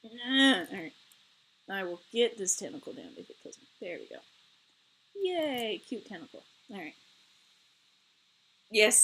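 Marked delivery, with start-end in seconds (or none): 0.0-7.0 muttering to self. Real, not acted. Fiddling with something off-camera.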